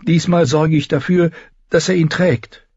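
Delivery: high-pitched, urgent plea; pleading young queen; begging. A narrator's reading. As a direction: beg the healer for help. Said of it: "bettelte Dani".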